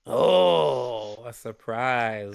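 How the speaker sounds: low, drawn-out intrigued "oh". Acted. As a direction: savour the intrigue slowly. Friend reacts to a secret plan.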